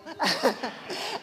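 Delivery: real laughter rising up; loud laugh. Real, not acted. Still amused at her joke, loud laughter. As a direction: let the laugh ring out. Joking about God's pronoun.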